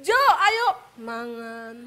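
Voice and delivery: call with falling end. Shown as a demonstration. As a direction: rise on Jo, ayo then let mangan fall. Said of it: "harus mengingat-ingat setiap kali manggil dia".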